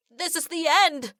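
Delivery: high panicked cry; terrified cry. A narrator's reading. Sailor crying out inside the fish, terrified. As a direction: wail it in terror. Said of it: "a sailor cries out in terror".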